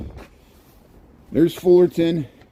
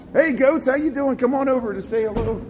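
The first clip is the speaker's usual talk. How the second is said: much higher, playful voice for greeting the goats; talking to the goats; 11 semitones higher than usual. Pretend. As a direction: chat up the goats in a high, goofy voice. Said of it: "Let's converse here in goat speak".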